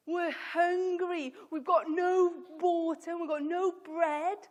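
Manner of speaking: loud, high, drawn-out complaint; hammed-up moaning. Teacher voicing hungry Israelites moaning at God, loud exaggerated complaint. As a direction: wail it slowly, stretching the key words. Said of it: "they were moaning at God".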